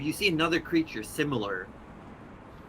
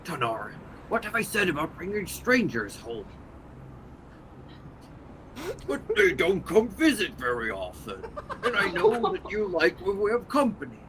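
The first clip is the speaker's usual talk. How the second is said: scolding creature voice; 6 semitones higher than usual. Acted. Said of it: "in Abyssal goes".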